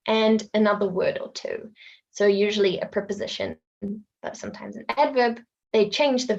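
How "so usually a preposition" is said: ordinary talk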